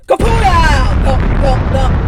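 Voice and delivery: loud popping blowout then thumps; tire blowout. Acted. The tire going flat, loud pop and thumps. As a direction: burst it out like a tire.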